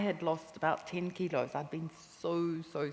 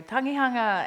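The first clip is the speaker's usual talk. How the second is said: calling from hilltop; 6 semitones higher, 7 dB louder than usual. Retold or imitated. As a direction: call it out from the top of a hill. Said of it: "she used to call from the top of the hill".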